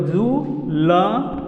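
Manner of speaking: "ज्वेलरी" drawn out as joo... la... ree; "ज्वेलरी" in three long separate syllables; corrected pronunciation. Shown as a demonstration. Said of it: "direct हम बता रहे हैं".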